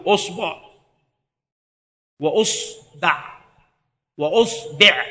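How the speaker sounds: أصبع with damma on the hamza, ba with fatha, damma, kasra; slow drill of أصبع opening with u; vowel variants. Shown as a demonstration. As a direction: say أصبع three ways, usbaʕ, usbuʕ, usbiʕ. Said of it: "ويجوز في الباء ثلاثة أوجه أيضًا".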